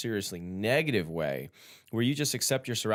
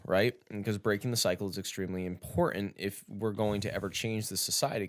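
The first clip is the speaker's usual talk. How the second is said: normal talking voice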